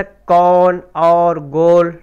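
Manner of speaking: कौन और गोल said very slowly with the vowel held long; drawn-out o. Shown as a demonstration. Teacher shows students the ओ sound, slow and held. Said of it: "इसमें ओ की आवाज़ निकलती है".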